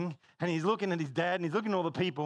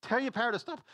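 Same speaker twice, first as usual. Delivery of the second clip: mock-angry raised pitch; incensed; 9 semitones higher, 4 dB louder than usual. Incensed customer complaining to the parrot's owner, mock angry. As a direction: act an irritated customer complaining. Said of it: "And the guy's incensed".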